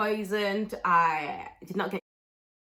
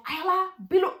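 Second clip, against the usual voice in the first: calling out; 9 semitones higher than usual. Retold or imitated. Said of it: "she was like".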